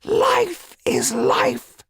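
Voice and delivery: drawn-out call to her dancing people; mock shout. A narrator's reading.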